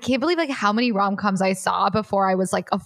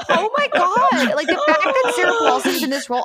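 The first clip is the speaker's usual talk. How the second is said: excited; 11 semitones higher, 4 dB louder than usual. Real, not acted. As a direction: squeal it out in amused disbelief. Excited about Sarah Paulson casting.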